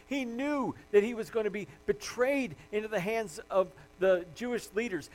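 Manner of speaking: ordinary talk, nothing marked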